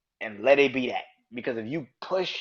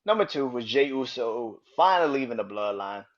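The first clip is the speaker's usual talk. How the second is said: normal talking voice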